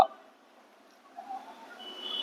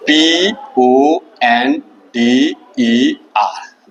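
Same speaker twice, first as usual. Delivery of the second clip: very slow, each letter held apart; slow dictation; much slower than usual. Shown as a demonstration. Teacher dictating the spelling to students, very slowly. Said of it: "वो word क्या है देखिए".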